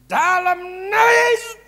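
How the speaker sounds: slow, loud, high shouted rebuke; slurred shouting rebuke; garbled shout. Shown as a demonstration. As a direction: yell it so hard the words blur. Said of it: "Sampai nggak jelas kan kedengarannya".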